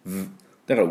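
ordinary delivery

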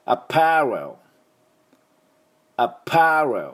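teacher's model アパレル, stressed パ; model pronunciation. Shown as a demonstration. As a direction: give アパレル as a model for students to repeat. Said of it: "私の後で発音いきましょう".

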